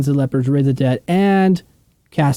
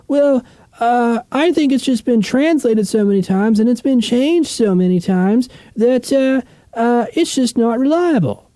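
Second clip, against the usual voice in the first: higher, hammed-up voice of a doubting skeptic; mock skeptic; 8 semitones higher than usual. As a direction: play a know-it-all doubter, lightly mocking. Mimicking a skeptic of the Bible.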